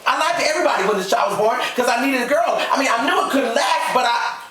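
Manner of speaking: high-pitched desperate pleading; frantic confession. Acted. Wife confessing her lie to husband, frantically. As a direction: plead at the top of your register.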